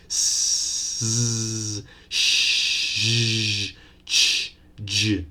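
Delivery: "s, sh, ch, j" hissed out one by one; sibilant sounds demo. Shown as a demonstration. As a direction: push air through each of "s, sh, ch, j". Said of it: "turbulence of air when pronouncing these six sounds".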